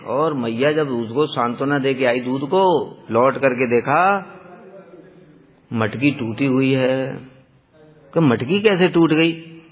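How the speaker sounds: ordinary talk, nothing marked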